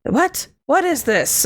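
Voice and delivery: high, hammed-up baffled game line; mock-dramatic puzzled outburst; Barry impression. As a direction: imitate a startled game character, pitched well up. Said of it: "with that exact inflection".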